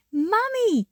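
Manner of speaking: put-on high excited child's yell; little girl yelling for her mum. A narrator's reading. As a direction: call out to Mum, thrilled. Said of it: "yelled Jody".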